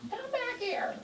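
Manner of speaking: high, mock call after the dog. Owner calling after the bolting dog, high mock call. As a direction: call after a runaway pet.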